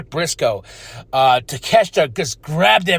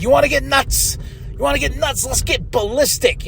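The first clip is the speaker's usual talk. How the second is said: high, worked-up wrestler on the mic; Toni Storm; 7 semitones higher than usual. Acted. Toni Storm challenging rivals on the mic, high and theatrical. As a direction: challenge them in a raised, theatrical pitch. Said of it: "Toni grabs the mic and she's like".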